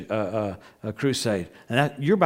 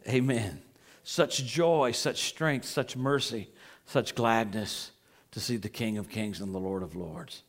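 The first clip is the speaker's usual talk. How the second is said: awed hush; 5 semitones lower, 7 dB quieter, slower than usual. Real, not acted. Awed hush about seeing the King.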